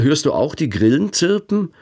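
normal talking voice

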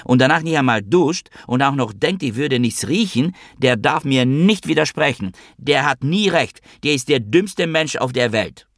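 high, swooping indignant rant; outraged mother's scolding; yelling. A narrator's reading. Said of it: "brüllte Mama".

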